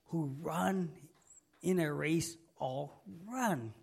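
usual speaking voice

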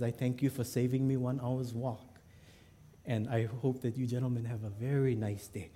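quietly courteous, measured farewell; understated, composed stranger's goodbye; soft formal politeness. Acted. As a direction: thank them softly and formally. Cold stranger's polite farewell in story.

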